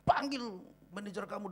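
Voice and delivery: high-pitched mock irate customer; indignant demand, only half yelled; retold loud demand. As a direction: imitate a customer calling for the manager. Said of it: "kenceng banget gitu kan".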